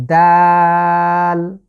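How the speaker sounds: দাল drawn out and loud; long Arabic dal. Shown as a demonstration. Teacher shows viewers the letter dal, drawn out. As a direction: hold দাল for three counts. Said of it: "এই দাল উচ্চারণে আমরা তিন আলিফ long করব".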